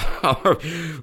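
high laugh from the heart; hearty laugh. A narrator's reading. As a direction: let Holmes laugh freely at the insult. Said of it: "rit de bon cœur". (rire franc et aigu)

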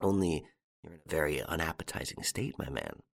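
low, hushed aside; muttered low, dropping at the end; between the teeth. A narrator's reading. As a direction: say it softly through clenched teeth. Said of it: "he added between his teeth".

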